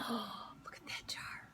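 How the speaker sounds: hushed unvoiced whisper; a soft gasp then whispered aside. Real, not acted. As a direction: lean in and whisper about the picture. Aside to kids about picture.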